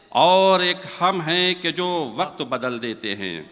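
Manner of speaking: measured, lilting verse delivery; poem recitation. Acted. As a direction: recite it like a mushaira couplet.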